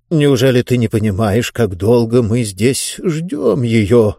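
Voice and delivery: ordinary delivery, nothing marked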